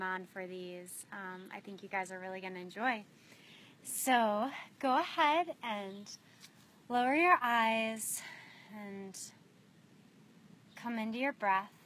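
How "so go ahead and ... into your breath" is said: unhurried meditation guide with long pauses; spacious, measured guiding voice, letting each image settle; guided meditation. Real, not acted. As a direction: lead them gently inward, phrase by phrase.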